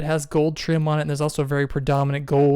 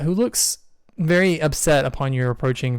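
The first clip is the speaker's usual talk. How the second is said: ordinary delivery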